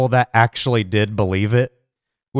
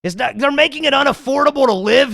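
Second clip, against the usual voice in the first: mock doom shout; 8 semitones higher than usual. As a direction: wail the doom like a hammed-up alarmist.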